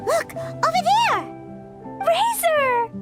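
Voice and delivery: high, excited shout to a friend; excited calling out. Acted. Child spots missing Razor over there, excited call. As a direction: call out as you spot him.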